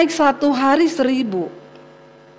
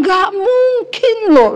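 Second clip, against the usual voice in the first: high, drawn-out mock disbelief; retold shock; 8 semitones higher, slower than usual. Husband refuses to believe his wife painted it, high mock disbelief. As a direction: stretch it out slowly, acting astounded. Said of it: "dia syok".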